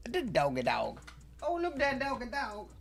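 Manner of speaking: cooing at dog. Real, not acted.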